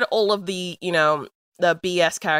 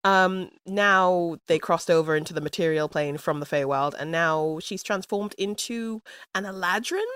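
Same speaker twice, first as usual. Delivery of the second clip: usual speaking voice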